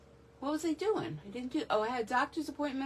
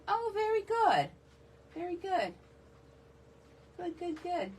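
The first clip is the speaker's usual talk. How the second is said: delighted response; 8 semitones higher than usual. Real, not acted. Reacting to viewer's family visit.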